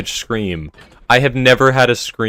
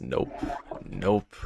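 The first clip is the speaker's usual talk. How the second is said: ordinary delivery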